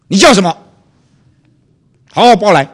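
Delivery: loud, high commanding demand; retold stern shout. Pastor demanding the policeman's name, loud stern shout. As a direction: demand his name like a superior. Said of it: "门口发了一发".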